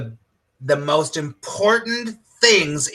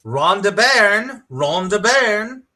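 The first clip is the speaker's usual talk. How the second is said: badly faked Australian accent, slow and higher; horrible Australian accent; 5 semitones higher, slower than usual. Shown as a demonstration. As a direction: draw the name out in a mock Australian twang. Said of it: "that's my horrible Australian accent".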